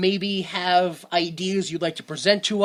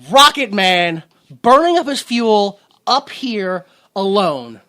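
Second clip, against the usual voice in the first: half-sing it with big swells; belted reading; 7 dB louder than usual. Acted. Host joining the Rocket Man reading, loud and swelling. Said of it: "spoken word dramatic reading".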